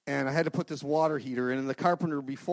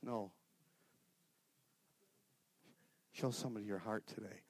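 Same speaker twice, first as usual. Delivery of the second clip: calm reply; 4 semitones lower, 12 dB quieter than usual. Retold or imitated. God's calm answer after outburst.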